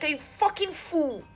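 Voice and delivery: reenacted angry challenge; retold cussing out. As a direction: throw it as an exasperated insult. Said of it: "I cussed him".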